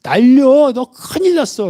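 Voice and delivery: high-pitched acted scolding; angry sister. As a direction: play an outraged sister telling off her sibling. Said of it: "막 화를 냅니다". (언니가 화내며 야단치듯 높은 목소리로)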